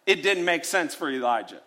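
ordinary talk, nothing marked

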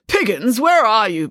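calling loudly from inside the house; father bellowing for the nanny from inside. Acted. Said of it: "bellowed Mister Green".

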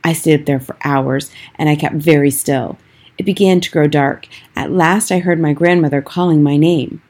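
plain reading voice

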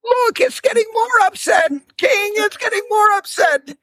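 very high, urgent calls from the side; squeaky little rabbit crying out in alarm; high-pitched frantic warning; rabbit calling out. A narrator's reading. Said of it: "the rabbit started calling".

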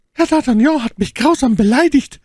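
very high, loud character voice; outraged cry. A narrator's reading. Milady complaining of D'Artagnan's insult, high loud outcry. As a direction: cry out the grievance as an injured lady. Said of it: "rief My Lady mit dumpfer Stimme".

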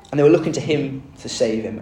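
ordinary delivery

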